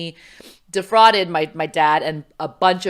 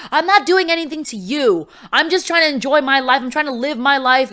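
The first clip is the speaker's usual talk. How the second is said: higher, louder defiant whine; defiant teen; 9 semitones higher, 5 dB louder than usual. Retold or imitated. Teen daughter snaps back at her waiting mom. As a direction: push back like an angry teenager. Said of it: "And I'm like".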